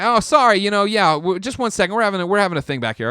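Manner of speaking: high, loud, hurried mock apology; apologetic staffer imitation. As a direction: rush an apology as a busy technician. Imitating apologetic scanner staff interrupting.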